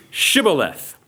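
"Shibboleth" with a deliberate SH sound at the start. Shown as a demonstration. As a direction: say "Shibboleth" slowly, opening on a clear SH. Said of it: "They would say to them".

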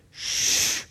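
soft breathy shush. Acted. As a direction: hush the listener into silence. God shushes Habakkuk.

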